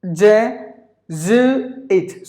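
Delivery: "Jesuit" broken slowly into je, zoo, it; three separate beats on "Jesuit"; syllable by syllable. Shown as a demonstration. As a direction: sound out "Jesuit" with pauses between syllables. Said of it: "there are three syllables in this word".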